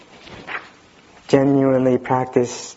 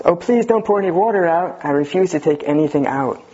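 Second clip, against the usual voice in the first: higher-pitched mock protest, easing toward the end; retold yelp of protest; 7 semitones higher than usual. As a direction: object in a mild mock yell, pitched up. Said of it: "The person jumps up and yells".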